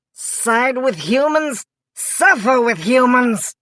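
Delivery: slow, stern royal declaration; shouting. A narrator's reading. Frog King threatens Mikey, a slow stern shout. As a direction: pronounce it like a threat. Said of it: "shouted Attila".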